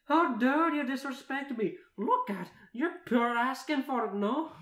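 very high, loud indignant yell; furious character voice at full volume; losing her temper. A narrator's reading. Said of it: "she raises her voice even further".

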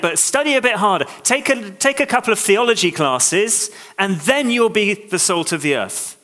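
hammed-up demand to try harder; mock pushy preacher.

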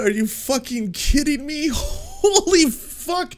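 can't believe the words he just heard; incredulous. Real, not acted. Host repeating the guest's line back in high, stunned disbelief.

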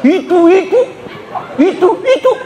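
high nagging "itu, itu" in a mocking wife voice. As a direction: parody a wife harping on old faults. Nagging wife mocked, high far.

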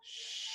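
high soft shh for hush; shushing hiss. A narrator's reading. Reader hushing kids: soft high shh.

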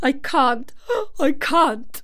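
high, loud tearful gasps; helpless sobbing child; gasping sobs. A narrator's reading. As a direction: catch your breath between sobbed words. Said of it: "he gasped and sobbed".

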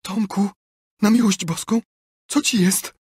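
ordinary delivery, nothing marked

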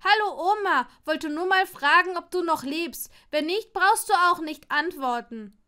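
loud, high mock-cheerful letter voice; sarcastic mock letter. Neville mocking Draco's owl idea, loud sarcastic letter. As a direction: read out a silly letter with bitter irony. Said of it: "Und was soll ich schreiben".

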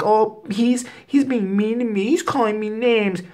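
high, whiny mock complaint; whiny girlfriend imitation. Girlfriend whining to her boyfriend, high and whiny. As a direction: pout like a wronged girlfriend. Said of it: "she's like".

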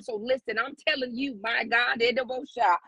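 normal talking voice

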